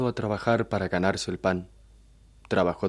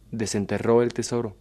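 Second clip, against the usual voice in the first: plain reading voice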